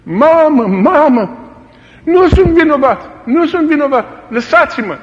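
high, loud pleading wail; tortured prisoner begging. Retold or imitated. Tortured prisoner begging the guards, loud pleading wail. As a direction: beg them to stop, in pain. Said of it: "strige mama".

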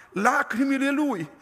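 ordinary talk

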